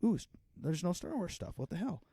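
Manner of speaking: put-on high, startled kid at an empty toy shelf; mock kid surprise. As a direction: squeak it out like a let-down kid. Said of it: "I'm like".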